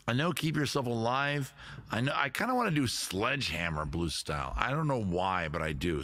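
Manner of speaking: ordinary delivery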